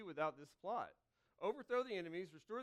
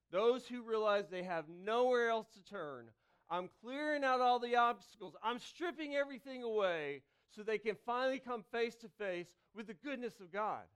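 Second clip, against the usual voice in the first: louder, driving sermon climax; preaching at full force; 3 semitones higher, 7 dB louder than usual. Acted. Preacher to congregation, rising forceful preaching. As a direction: preach it out over the room.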